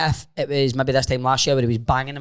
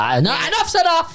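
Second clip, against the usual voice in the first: much higher exasperated put-on; mock exasperated outburst. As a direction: throw up your hands in mock fury. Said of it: "he's like".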